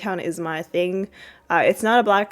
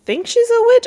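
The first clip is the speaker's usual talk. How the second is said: high unsure guess; 7 dB louder than usual. Real, not acted.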